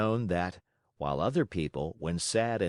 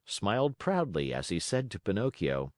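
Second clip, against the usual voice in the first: ordinary delivery, nothing marked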